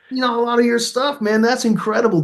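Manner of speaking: high-pitched, impressed fan; retold excited compliment; gushing praise. Retold fan praising his work.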